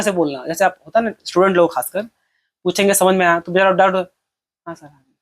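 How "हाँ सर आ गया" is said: barely audible, very soft student reply; mumbled, too quiet. Acted. Shy student answering teacher about doubts, mumbling very softly. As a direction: swallow the answer almost to nothing. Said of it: "इतना धीरे बोलिए और क्या समझ में आया?".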